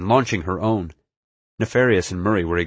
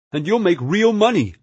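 high, loud eager cry; excited, high-voiced urging; 12 semitones higher, 5 dB louder than usual. A narrator's reading. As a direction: cry out the promise, pitched way up. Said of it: "Murray cried".

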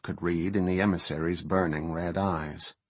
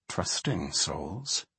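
soft, slow dry mutter; 3 semitones lower, 8 dB quieter, much slower than usual. A narrator's reading. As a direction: mutter it under your breath. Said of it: "Malfurion muttered".

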